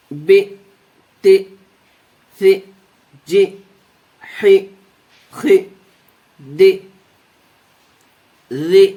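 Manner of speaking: short, crisp, even beats on each letter; fluent letter recitation. Shown as a demonstration. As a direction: tick off each letter briskly without stretching.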